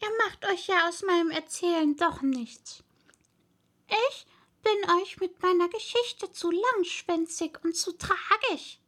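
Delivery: high, aggrieved little mouse voice; sighing mouse. A narrator's reading. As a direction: complain in a high, tiny voice. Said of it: "seufzte das Mäuslein".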